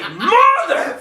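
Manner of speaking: high mock scream, half laughing; retold furious outburst. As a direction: blow up on the curse. Retells his angry reaction.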